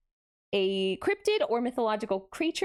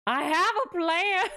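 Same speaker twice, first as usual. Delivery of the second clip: slow, lightly voiced scheming gang leader; a hint of Dutch, breaking into a laugh; Dutch impression; 5 semitones higher than usual. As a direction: deliver it like a smug outlaw boss. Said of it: "Was that a good Dutch?".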